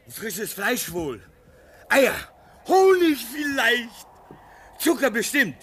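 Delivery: put-on young man thrilled by the air drop; excited unpacking. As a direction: hurry and guess the contents with glee. Unpacking the dropped supplies.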